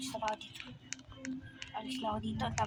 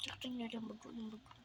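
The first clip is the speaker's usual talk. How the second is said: hushed; 2 semitones lower, 15 dB quieter, faster than usual. Acted. Hushed hiding.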